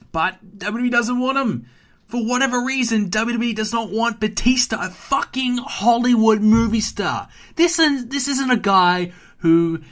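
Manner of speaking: loud, high-pitched exasperated outburst; indignant rant. Real, not acted. Ranting about WWE rejecting Batista.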